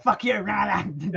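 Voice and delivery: put-on heckling voice; defiant dog. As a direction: hurl it slowly as a cheeky animal. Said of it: "but the dog's like".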